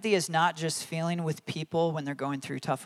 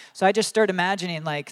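ordinary talk

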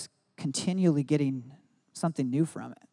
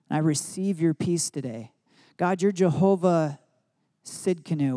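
earnest prayer. Shown as a demonstration. Prays declarations aloud.

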